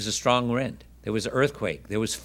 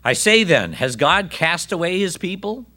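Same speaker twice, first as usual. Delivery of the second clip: higher and louder, pressing question; emphatic question; 6 semitones higher, 3 dB louder than usual. Shown as a demonstration. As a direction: ask it with urgent force. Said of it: "Paul asks".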